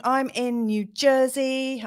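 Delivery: slow, attempted New Jersey accent; a self-conscious stab at New Jersey speech. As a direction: have a playful go at a New Jersey accent, drawn out. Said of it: "I can't do the accent".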